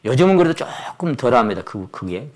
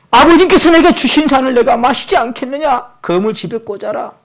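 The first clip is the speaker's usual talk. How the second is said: higher, louder commanding Jesus; Jesus commanding Peter, raised and firm; firm raised rebuke; 9 semitones higher, 5 dB louder than usual. Acted. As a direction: declare it over the sword.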